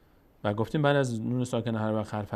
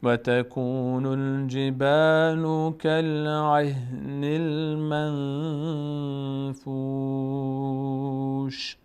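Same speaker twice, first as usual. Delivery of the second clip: slow, louder, melodic tajwid recitation; Quran recitation; 3 dB louder, much slower than usual. Shown as a demonstration. Teacher reciting the surah for students, slow chant. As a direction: chant each verse slowly with long held vowels.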